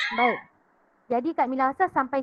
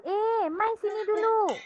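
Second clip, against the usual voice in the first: Kedah dialect call; 8 semitones higher than usual. Shown as a demonstration. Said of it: "Okey, contohlah".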